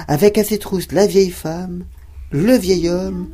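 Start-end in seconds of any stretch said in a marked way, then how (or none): none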